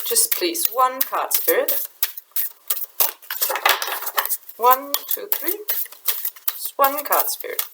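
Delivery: softly asking Spirit for one card, barely above a murmur; hushed plea. Real, not acted. Pleading softly to Spirit for one card, hushed.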